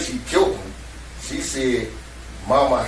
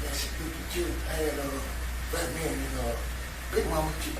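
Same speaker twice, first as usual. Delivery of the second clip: hushed; 10 dB quieter than usual. Retold or imitated. Telling wife quietly, daughter upstairs.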